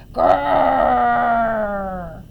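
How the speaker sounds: loud, long bear growl; sustained storybook bear snarl. A narrator's reading. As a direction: growl out a drawn grrr like a bear. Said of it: "growled the bear".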